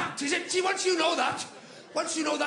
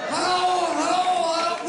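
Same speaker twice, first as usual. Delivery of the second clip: calling out hello; 3 semitones higher, 6 dB louder than usual. Pretend. Calling out greeting to the royal guest.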